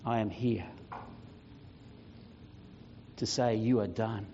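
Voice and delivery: low, soft, with long weighty pauses; solemn declaration. Acted. Jesus tells the devil his power is broken, solemn declaration. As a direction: pronounce it with quiet, final authority. Reads Jesus's letter to Death.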